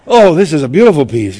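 loud fake admiration of the lettuce; mock admiring. Preacher acting out hiding in the produce aisle, loud mock admiration. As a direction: put on a show to dodge someone.

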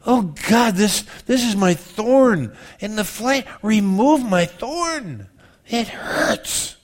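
high, slow plea ending in a whispered it hurts; mock pained prayer for the thorn; anguished prayer. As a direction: beg with hurt in the voice. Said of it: "you've never prayed that".

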